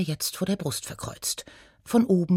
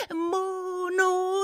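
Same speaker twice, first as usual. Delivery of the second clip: "Monoton" held high on one note; savoured word; 14 semitones higher, slower than usual. Acted. Gnome relishing her favourite word, long high "Monoton". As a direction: intone "Monoton" slowly, high, every o stretched.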